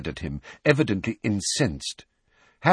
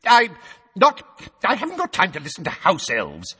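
high, loud exasperated minister; shouted; 13 semitones higher, 4 dB louder than usual. A narrator's reading. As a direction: sputter then shout. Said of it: "Fudge shouted".

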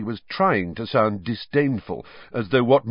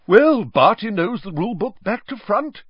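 much higher, louder jolly aside; cheerful beaming; 12 semitones higher, 7 dB louder than usual. A narrator's reading. Bagman brushing off the quarrel, beaming and jolly. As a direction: grin through the line.

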